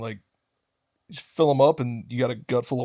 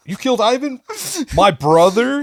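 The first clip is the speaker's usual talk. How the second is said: mock outraged mob boss; 9 semitones higher, 3 dB louder, slower than usual. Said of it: "he's like".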